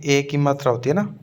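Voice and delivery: usual speaking voice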